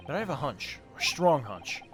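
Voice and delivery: usual speaking voice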